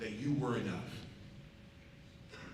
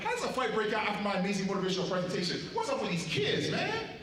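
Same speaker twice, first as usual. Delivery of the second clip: fast, rising disbelief ending on a high "man"; quick exasperated question climbing high at the end; mock outrage; 6 semitones higher than usual. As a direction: play up the comic shock that a fight broke out after his talk. Fight breaks out after his talk.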